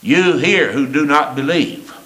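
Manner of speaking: ordinary delivery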